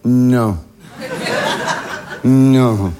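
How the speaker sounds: low, dismayed no; mock dread, low. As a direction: deny it in low horror. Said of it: "je fais".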